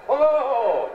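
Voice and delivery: high, loud mock "Oh, ho, ho"; hammed-up hooting cry. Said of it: "if you cry like that".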